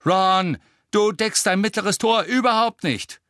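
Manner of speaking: high, loud scolding shout; loud, exasperated telling-off. A narrator's reading. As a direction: yell it across the pitch. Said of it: "schrie Angelina".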